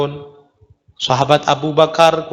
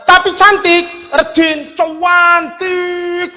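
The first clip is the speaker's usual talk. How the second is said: much higher, slow, louder "cantik"; ironic high praise; 11 semitones higher, 3 dB louder, much slower than usual. Acted. Preacher mocking the unwashed beauty, high ironic praise. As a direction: squeal the praise sarcastically.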